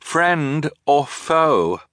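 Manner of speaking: slow, wary question down the hatch; wary call. A narrator's reading. Siblings calling down a dark hatch, slow and wary. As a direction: ask it slowly, unsure who answers. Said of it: "they wanted to say".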